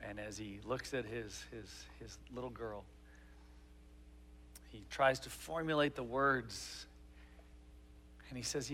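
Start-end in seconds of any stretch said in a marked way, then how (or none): none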